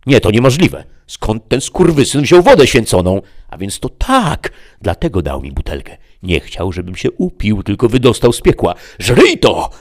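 high, loud outraged devil; a demon furious at betrayal; shrieking realization then attack; Mefisto raging. Acted. Mefisto raging at holy water.

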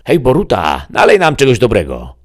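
loud call across the bar. A narrator's reading. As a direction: hail the barman from below. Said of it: "zawołał Mefisto". (Mefisto woła głośno do barmana)

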